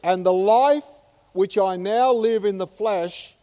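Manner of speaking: loud, high proclaiming of the verse; slowing to a hushed end on lives in me; fervent preaching. Acted. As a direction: declare it like a triumph. Continuing fervent scripture.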